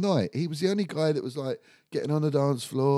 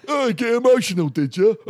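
higher, louder jeering tease; mocking lads; 9 semitones higher, 8 dB louder than usual. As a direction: jeer at him like the other lads. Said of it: "the other lads are like".

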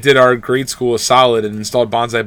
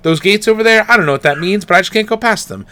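voicing the dog; 7 semitones higher than usual. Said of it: "he used to be like".

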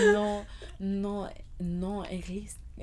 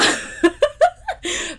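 high laughing fit; 13 semitones higher, 11 dB louder than usual. Real, not acted. Cracking up at own joke.

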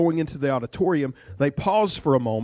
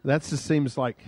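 normal talking voice

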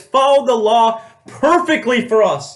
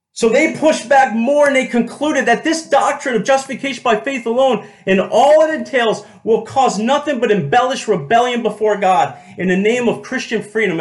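ordinary delivery

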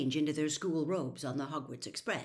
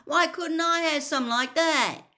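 high, loud indignant shout; aggrieved, yelled complaint; angry; 11 semitones higher, 10 dB louder than usual. A narrator's reading. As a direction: wail at the unfairness of it. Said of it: "said Ron angrily".

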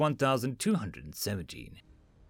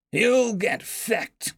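alien drawl; 10 semitones higher, much slower than usual. Acted. Alien Dresk quips after laughing.

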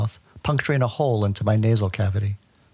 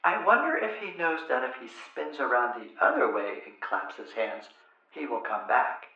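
soft, musing wonder, much softer; calm and inquisitive; 2 semitones higher, 8 dB quieter than usual. Retold or imitated. Mother hinting the cure to her crying son, soft calm musing. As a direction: muse aloud as if to nobody. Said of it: "calmly and inquisitively asked".

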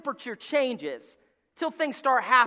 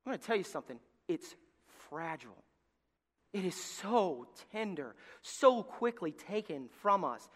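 hushed sermon; 3 semitones lower, 8 dB quieter than usual. Real, not acted. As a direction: let it sink in quietly. Hushed after the sad story.

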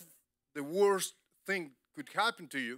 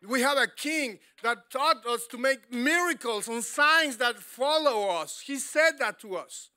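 loud, rising preacher's cry; full-throated sermon build; preaching at full force; 7 semitones higher, 8 dB louder than usual. Acted. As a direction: preach it big to the church.

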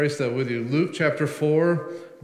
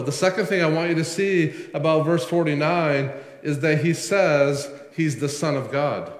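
normal talking voice

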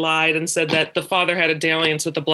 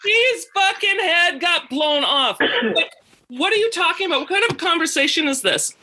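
high-pitched, incredulous re-enactment; outraged disbelief; 11 semitones higher than usual. Retold or imitated. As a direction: replay your exasperation, pitch way up. Outraged comeback in retold argument.

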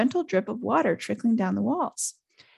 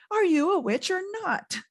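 much higher exasperated yell; bellowing; 11 semitones higher than usual. A narrator's reading. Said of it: "Ron bellowed".

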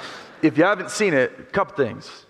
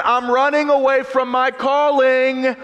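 slow anguished inner voice; 8 semitones higher than usual. Retold or imitated. Simba's inner voice.

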